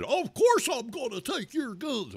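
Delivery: high-pitched mocking mimicry; Beto O'Rourke. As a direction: parody a politician in a silly high voice. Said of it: "Beto O'Rourke said".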